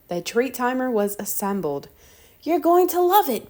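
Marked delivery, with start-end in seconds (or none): 2.4-3.5 high, loud, thrilled; excited. Acted. Arthur to his dog Pal, high and thrilled. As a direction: gush it to the dog.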